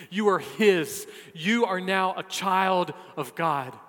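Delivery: emphatic preacher, voice lifted on the key words; impassioned preaching. Real, not acted. Preacher telling believers they are now His, impassioned. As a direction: drive each phrase home from the pulpit.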